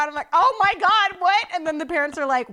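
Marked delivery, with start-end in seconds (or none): 0.3-1.5 loud, high-pitched disbelief; retold shock. Hearing about a seatmate's dirty diaper, shocked. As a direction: burst out in mock horror.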